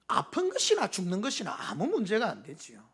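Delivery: usual speaking voice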